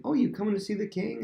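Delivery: high-pitched eager question; feigned interest. Acted. Prince asking a visitor at the gate, feigned interest. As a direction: lift it high, all friendly curiosity.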